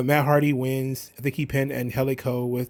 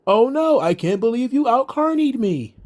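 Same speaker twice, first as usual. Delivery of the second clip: higher, lightly voiced Hardy, hamming up a too-calm "oh no"; mock his limp, no-surprise reaction, pitched up; lightly mocking Matt Hardy, pitched up and calm; 8 semitones higher than usual. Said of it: "very calmly, it's like".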